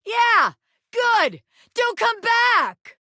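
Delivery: high, loud, drawn-out yell; triumphant angry shout. Acted. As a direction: shout them out the door. Character shouting rival away.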